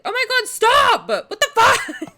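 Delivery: high, loud shriek at the screen; startled shout. Real, not acted. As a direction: yell in real shock, stretching "stop". Banners flood in, she screams.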